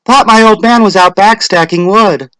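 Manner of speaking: high, loud chatty wife; Missus Hutchinson. A narrator's reading. Latecomer explains to her neighbour, high chatty voice. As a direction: rattle it off like a flustered housewife. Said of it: "Missus Hutchinson went on".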